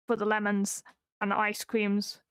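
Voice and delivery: usual speaking voice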